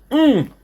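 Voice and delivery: high, falling hum of delight; high-pitched yummy moan; savouring "mm". Real, not acted. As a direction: hum appreciatively over a tasty bite. Savouring a bite of croissant.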